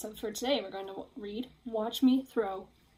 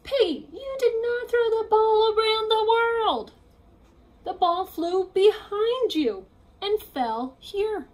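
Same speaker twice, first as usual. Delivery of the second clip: higher, louder exasperated telling-off; Gerald correcting; 11 semitones higher, 8 dB louder, slower than usual. Acted. Gerald telling Piggy the ball barely moved, exasperated. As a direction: set the record straight firmly.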